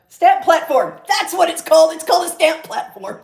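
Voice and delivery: high, loud burst of discovery; triumphant recall. Real, not acted. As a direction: exclaim it as the name finally comes back. Suddenly recalls the product's name.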